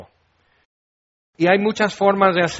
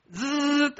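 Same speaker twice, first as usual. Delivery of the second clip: high, soft buzzing noise; sudden mimicked bell buzz; doorbell buzz; 8 semitones higher, 6 dB quieter than usual. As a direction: imitate a doorbell's buzzer. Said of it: "el timbre".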